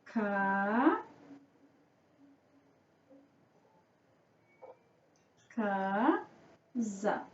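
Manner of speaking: soft, low "Ca", a long pause, then "casa" split up; quiet, stretched syllable dictation of "casa". Shown as a demonstration. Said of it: "Vamos fazer a divisão silábica".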